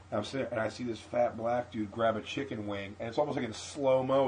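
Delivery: ordinary talk, nothing marked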